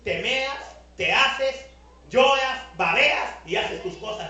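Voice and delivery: mother shouting a scolding from the doorway; retold yell. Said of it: "todavía le gritó de la puerta".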